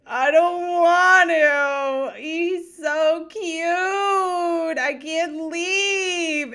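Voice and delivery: high, slow, loud fake-crying kid; hammed-up child tantrum, crying; child's whiny wail. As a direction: wail like a kid dragged from a puppy. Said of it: "she's like".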